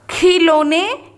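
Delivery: खिलौने read slowly as "khee-lo-ne"; misspelt reading. Shown as a demonstration. Teacher reading out the misspelt word, खिलौने as "khee-lo-ne". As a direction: stretch खिलौने with long ई and ओ. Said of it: "खिलौने नहीं होता".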